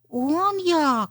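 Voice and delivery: high, musing little fox; thoughtful drawl. A narrator's reading. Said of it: "задумливо протягнула маленька лисичка".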